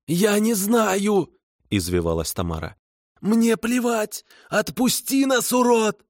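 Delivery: high, slow frantic wailing; desperate kikimora. A narrator's reading. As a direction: squeal in panic, trapped and furious. Kikimora writhing in water trap.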